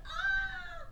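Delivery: long, high-pitched "ah" held on one note; mock scream. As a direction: let out a drawn-out squeal of mock alarm. Retold crowd reaction to a gunshot.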